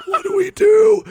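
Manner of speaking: very high, loud, cracking with laughter; hammed-up panic; laughing mock panic. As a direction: squeal it in giddy alarm. Gohan and Supreme Kai panicking.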